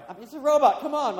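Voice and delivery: high, loud comic protest; mock exasperation. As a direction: burst out in laughing disbelief at yourself. Mock protest at his own tears.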